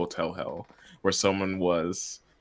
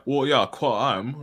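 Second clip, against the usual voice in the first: put-on slow, higher, overdone British accent; over-the-top British accent; 7 semitones higher, 7 dB louder, slower than usual. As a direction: imitate a fake Sherlock British accent. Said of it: "the way they did the accent was".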